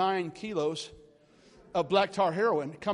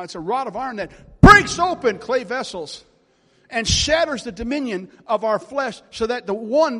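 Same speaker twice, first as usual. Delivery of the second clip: loud, punched proclamation; full-force preaching; 8 dB louder than usual. Real, not acted. Preacher proclaiming the rod of government to congregation, forceful preaching. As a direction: hammer the key words like a revival preacher.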